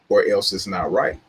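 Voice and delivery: ordinary talk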